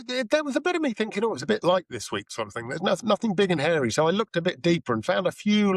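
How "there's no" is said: usual speaking voice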